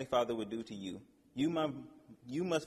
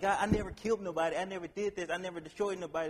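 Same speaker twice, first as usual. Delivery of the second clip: higher, pleading protest to God; higher voiced imitation of a defensive believer; mock self-defense; 6 semitones higher than usual. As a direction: play someone insisting they're innocent. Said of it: "But you're like".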